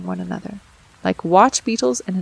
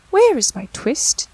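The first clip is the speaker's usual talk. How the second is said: put-on higher, anxious old tailor; tailor asking; 6 semitones higher, 3 dB louder than usual. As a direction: demand where the twist went. Tailor asks Simpkin.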